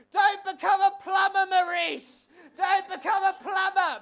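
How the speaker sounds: high, shrill-pitched mock scream at the TV; retold scream. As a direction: screech it at the screen like a desperate fan, slow on the last line. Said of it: "I literally found myself screaming".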